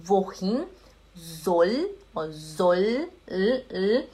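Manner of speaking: slow, separated words with lifted endings; word-by-word demonstration. Shown as a demonstration. German teacher breaking a phrase down for students, slow and word by word. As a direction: spell it out word by word, slowly. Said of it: "Seria assim".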